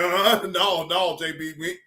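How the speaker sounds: lightly voiced low Iz impression; low mock voice. Quoting Iz denying it, mock low voice. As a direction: drop low to imitate Iz.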